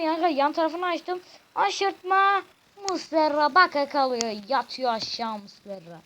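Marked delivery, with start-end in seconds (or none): 1.5-6.0 screaming football commentator. Acted. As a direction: yell the replay like a TV commentator. Commentating the chip goal.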